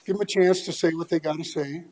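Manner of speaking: normal talking voice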